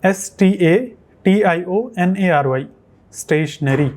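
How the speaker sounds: slow letter by letter, then "stationery"; spelling slowly. Shown as a demonstration. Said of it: "आपको बोलना है". (धीरे धीरे स्पेलिंग बोलना)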